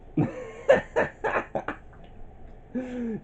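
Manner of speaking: high, helpless giggling; long high-pitched giggle fit; real giggle. Real, not acted. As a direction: giggle away at your own idea. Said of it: "makes me giggle".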